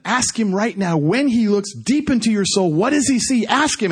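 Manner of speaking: high, pressing, rising in urgency; insistent urging. Retold or imitated. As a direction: act out overriding his fear, urgently. Urging him again over his objection.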